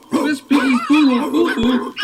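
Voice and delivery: ordinary delivery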